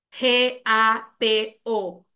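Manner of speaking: each letter said slowly and separately; spelled out slowly. Shown as a demonstration. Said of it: "deletrea la palabra". (deletreado despacio, letra por letra)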